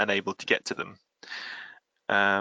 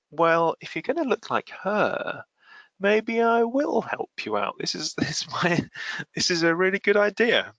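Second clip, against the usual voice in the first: leering Diablo; 10 semitones higher than usual. Retold or imitated. Diablo eyeing Aurora's body, in a hammed-up high leering voice.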